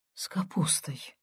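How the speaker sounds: near-whispered, faint reply; timid babble. A narrator's reading. Stunned grandmother answering about the pie, babbled in a whisper. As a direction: barely breathe the words out. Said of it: "пролепетала баба".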